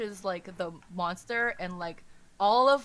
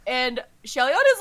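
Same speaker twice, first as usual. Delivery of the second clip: ordinary talk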